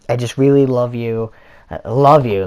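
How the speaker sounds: loud, insistent mock declaration of love; emphatic imitation of an overeager admirer; imitated love confession. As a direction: blurt out the love like a smitten stalker. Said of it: "that's exactly how she said it".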